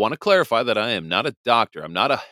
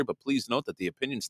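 usual speaking voice

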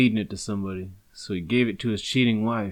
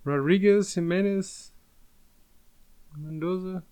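mock Mexican accent; 9 semitones higher than usual. Shown as a demonstration. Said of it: "see how many Mexican last names you can fit in".

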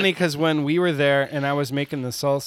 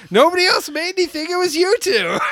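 accusing; 11 semitones higher, 4 dB louder than usual. Retold or imitated. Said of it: "you're like".

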